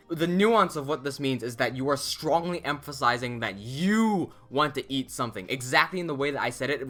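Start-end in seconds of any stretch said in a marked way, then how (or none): 3.4-5.5 YOU drawn out slowly and emphasized; stress on YOU. Shown as a demonstration. Teacher showing learners who wants to eat, heavy stress on YOU. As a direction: lean long and deep into the word "you". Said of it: "with a deep emphasis on the word you".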